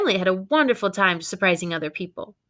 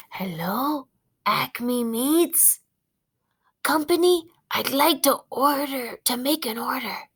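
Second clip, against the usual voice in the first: dog on the phone; 5 semitones higher than usual. Acted. Dog phones butcher shop.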